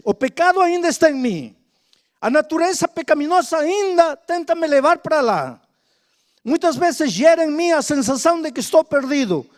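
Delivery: loud, driving pulpit delivery; full-force preaching. Acted. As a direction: preach it hard to the congregation.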